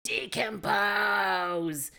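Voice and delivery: slow, drawn-out creature moan, rising high; monster moan. Retold or imitated. Imitating the monster groaning its own name at length. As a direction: groan the name like a rotting beast. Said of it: "he's just going".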